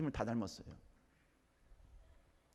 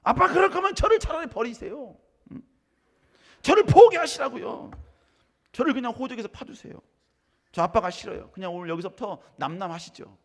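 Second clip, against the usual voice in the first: higher, louder rebellious son talking back; defiant son; 9 semitones higher, 12 dB louder than usual. Acted. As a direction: play the son snapping back at his father. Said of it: "대드는 겁니다". (아빠에게 대드는 아들, 반항적으로)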